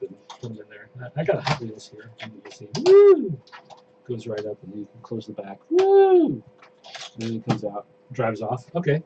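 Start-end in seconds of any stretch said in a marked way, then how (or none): 2.8-3.4 high, loud excited whoop; excited woo. Real, not acted. Car rolls up the ramp. 5.7-6.4 high, loud excited whoop; excited woo. Real, not acted. Car rolls off the trailer.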